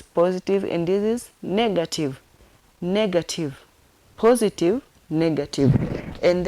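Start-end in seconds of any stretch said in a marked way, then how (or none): none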